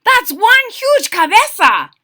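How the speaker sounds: high, loud astonished cry; amazed. Acted. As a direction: marvel at your own huge head. Kitten shocked at mirror.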